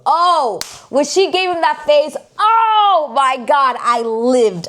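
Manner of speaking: high, loud fangirl outburst; thrilled gushing. Real, not acted. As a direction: squeal it with drawn-out delight. Gushing over favourite scene.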